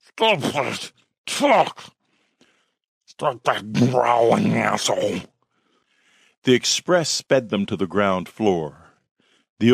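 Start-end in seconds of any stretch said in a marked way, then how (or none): 0.1-5.2 garbled, growled scolding; indistinct growl. A narrator's reading. Werewolf spouses snap at each other, growling indistinctly. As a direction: snarl the words like an animal. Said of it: "Her reply was an indistinct growl".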